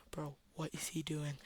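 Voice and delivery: very soft, low murmur; hushed aside. Retold or imitated. Standing beside Luke watching Marcus, hushed aside. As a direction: drop right down, barely voiced.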